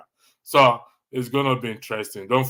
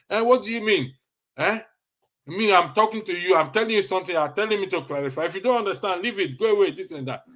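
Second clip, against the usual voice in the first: offended retort; 7 semitones higher than usual. Acted. They consider it as insult.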